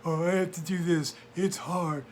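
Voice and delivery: lightly whiny, slowed excuses; whiny complaint. Retold or imitated. Mocking complacent voters, a lightly whiny voice. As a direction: moan it like a put-upon voter. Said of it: "We whine and moan".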